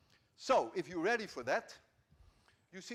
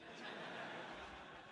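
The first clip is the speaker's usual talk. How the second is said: soft breathy laugh; 14 dB quieter than usual. Real, not acted. Laughs at own joke.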